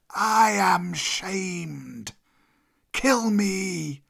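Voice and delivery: very slow, high, wailing serpent; long-drawn cobra lament; shamed old cobra. Acted. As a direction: stretch the words out in humiliation. Beaten cobra begs for death.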